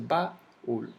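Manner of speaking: "Baúl" broken slowly into ba and úl; split syllables. Shown as a demonstration. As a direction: pull "Baúl" apart into two slow beats. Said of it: "se separan".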